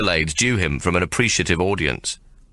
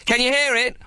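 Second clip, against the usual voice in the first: put-on high shout of an excited boy. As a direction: yell it over the racket, pitched up high. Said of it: "one of the boys shouts over the din".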